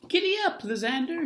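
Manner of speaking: high, loud call to the goat; crying out. Acted. Dottie urging the goat Lysander on, high and loud. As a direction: urge the goat to run. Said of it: "she cried to the goat".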